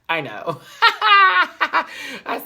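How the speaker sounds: loud, slow laugh through words. Real, not acted. Vlogger cracking up at Aja's remark, loud high laughing.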